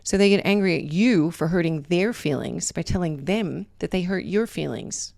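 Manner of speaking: usual speaking voice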